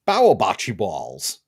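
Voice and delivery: slow, thick New York accent, "Bower bocce balls" drawn out. Shown as a demonstration. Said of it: "thick New York accent".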